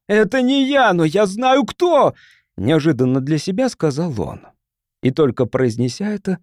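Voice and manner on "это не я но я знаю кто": high, frantic denial; shouting. A narrator's reading. Hunted man shouting at the advancing crowd, desperate. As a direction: cry out to save yourself. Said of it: "закричал он надвигающейся толпе".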